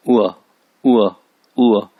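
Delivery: उआ from the throat, falling; punched, falling throaty उआ; throaty ain sound. Shown as a demonstration. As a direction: push उआ out from the throat like Arabic ain. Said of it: "आइन की आवाज़ निकलेगी".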